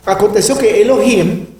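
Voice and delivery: usual speaking voice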